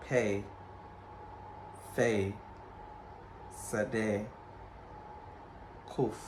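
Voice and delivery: each letter name alone, long pauses between; calm classroom reading of the Hebrew letters; alphabet recitation. Shown as a demonstration.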